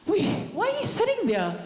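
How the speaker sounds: high, fast, startled cry after a gasp; gasp, then shoot up in alarm; startled question. Retold or imitated. Daughter startled to find Mum sitting in the dark, high alarmed cry.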